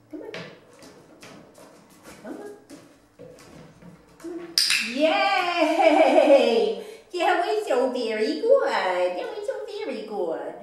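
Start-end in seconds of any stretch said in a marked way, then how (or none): 4.9-10.5 loud, high, laughing yay; excited cheering. Real, not acted. Cheering the dog's success.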